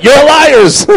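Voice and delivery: sudden high, loud yell; shouted accusation. Retold or imitated. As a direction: burst out at full force at the congregation. Urging translator, then yelling the rebuke.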